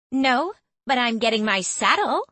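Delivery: high, bright excited chatter; bubbly excitement. Acted. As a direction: bounce with glee. Becky bouncing excitedly.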